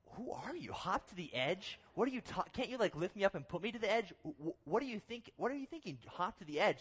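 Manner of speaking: high-pitched, stammering mock disbelief; hammed-up baffled objection; incredulous protest. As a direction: question the absurd order, pitch leaping up. Retold incredulous protest to guide.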